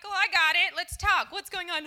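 hammed-up eager greeting; eager helper. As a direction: jump in brightly like a keen counselor. Eager counselor offering help.

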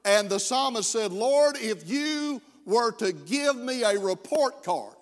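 full-force preaching. Acted. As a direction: press the weight of sin on the congregation.

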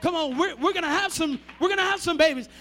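normal talking voice

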